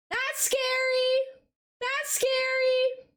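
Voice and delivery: high, slow ghostly moan; mock-spooky wail at the portraits. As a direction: draw it out like a ghost. Reacting to eerie portraits.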